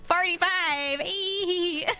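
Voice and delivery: gleeful mock shout. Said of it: "And I was like".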